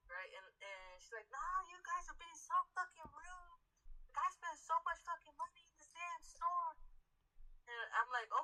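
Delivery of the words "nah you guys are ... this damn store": mock angry customer. Customer yelling again.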